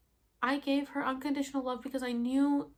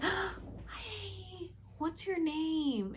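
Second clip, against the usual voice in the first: high, soft, drawn-out greeting; retold soft hello; 8 semitones higher, 5 dB quieter, much slower than usual. Woman under ayahuasca greeting her helper, soft high hello. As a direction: say it softly and high, stretching it out.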